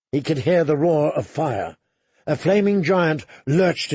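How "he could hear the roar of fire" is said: ordinary delivery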